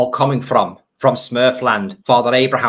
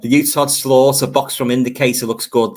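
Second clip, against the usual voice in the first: usual speaking voice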